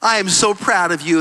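a hint of a tender dad; lightly voiced proud father. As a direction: reassure a broken son, gently. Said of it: "He's like".

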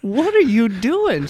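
slow, loud, high-pitched wifely outrage, acted; mock freak-out. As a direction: stretch out the appalled wife's shriek. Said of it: "the wife is just freaking out".

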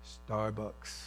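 low, hushed prompting; quiet, certain voice from within; inner voice. Acted. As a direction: say it low and very soft. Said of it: "the voice again says".

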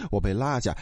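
ordinary delivery, nothing marked

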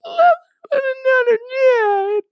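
very high, hammed-up sobbing voice; mock crying Mary. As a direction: wail it like an exaggerated weeping sister. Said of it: "and she's like".

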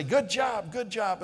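lightly acted upbeat dad praise; mock encouragement. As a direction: cheer on a little kid. Retelling praise to his young son.

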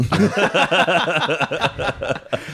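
big loud burst of laughter. Real, not acted. Laughing at the podcast joke, loud laughter.

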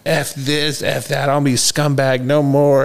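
high, mock-furious outburst of disgust; mad outburst. Throwing his stash out the window, high mock-angry outburst. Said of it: "I would get so mad".

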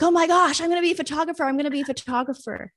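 thrilled, high-pitched sudden realization; excited realization. Retold or imitated. Telling of a career lightbulb moment, excited.

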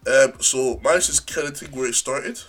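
normal talking voice

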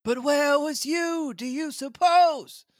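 put-on high, slow old castaway voice; Ben Gunn voice. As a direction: lift into a cracked old sailor. Ben Gunn voiced in read story.

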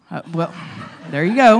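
ordinary delivery

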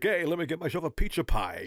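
tossed-off Brooklyn tough-guy voice; lightly done Brooklyn accent, half-hearted; mock Brooklyn accent. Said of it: "he's kinda doing a Brooklyn thing".

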